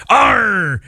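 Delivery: a quick high pirate "Arr"; pirate growl. Pretend. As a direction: toss out a pirate cry. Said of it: "Talk Like a Pirate Day".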